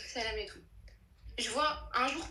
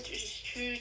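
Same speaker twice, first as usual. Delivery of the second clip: ordinary delivery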